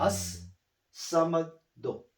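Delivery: As-Samado very slowly, long held S; doubled S. Shown as a demonstration. Teacher modelling for the student, slow "As-Samado" with S pronounced twice. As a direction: draw out "As-Samado", dwelling on the double S. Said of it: "you need to pronounce twice".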